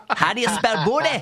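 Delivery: mock Australian quizmaster, with laughter; laughing through a hammed-up Aussie accent; broad Australian accent. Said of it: "thickest Australian accent possible".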